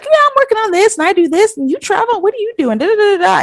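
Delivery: rattling on like the daughter; high chatty daughter imitation. Daughter chatting to the seething guest, high and quick.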